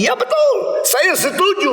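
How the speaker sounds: high, eager voice agreeing with a smug lilt; imitating an atheist. Preacher mimics an atheist agreeing, high mock eagerness. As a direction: play the atheist gladly nodding along. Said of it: "mereka mengatakan".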